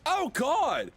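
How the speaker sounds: sudden high alarmed cry; shocked. Real, not acted. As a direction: yelp at a nasty surprise.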